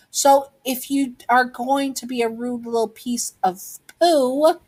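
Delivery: high-pitched, pointed acted warning; child's defiant comeback, punching the last word. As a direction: stand up to the bully, pitched high. Child threatens bully holding rock.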